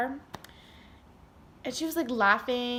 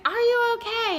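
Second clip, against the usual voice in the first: high, louder mock concern; worried sister; 12 semitones higher, 6 dB louder than usual. Sister checks on injured speaker, high mock concern. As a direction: do the sister's fussing voice. Sister asks after the fall.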